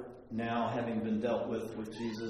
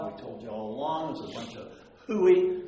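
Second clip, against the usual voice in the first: mocking scribes; 8 dB louder than usual. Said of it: "The scribes and Pharisees are mocking them".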